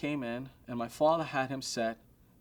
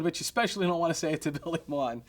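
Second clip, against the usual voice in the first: normal talking voice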